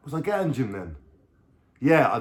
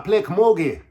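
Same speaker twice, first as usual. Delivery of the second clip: higher, louder mock Irish; 8 semitones higher, 6 dB louder than usual. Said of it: "she's like".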